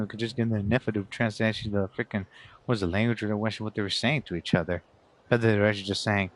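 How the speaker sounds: normal talking voice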